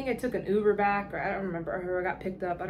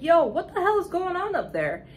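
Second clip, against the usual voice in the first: higher and louder, hailing a friend from the line; calling out; 8 semitones higher, 4 dB louder than usual. Retold or imitated. Calling out to a vendor from the back of the line, loud hail. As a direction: shout it up the queue to get his attention.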